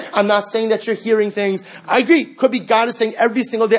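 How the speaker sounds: ordinary delivery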